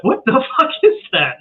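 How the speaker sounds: high, incredulous question with a laugh; acted bewilderment. Film crew hearing strange sounds, acted bewilderment. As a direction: pitch jumps up in disbelief. Acting crew's bewildered reaction.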